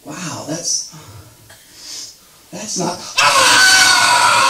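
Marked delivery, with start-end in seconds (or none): 3.2-4.5 high, loud shriek of panic; panicked scream. Pretend. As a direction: scream as the first hit goes wrong. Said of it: "This is my impression".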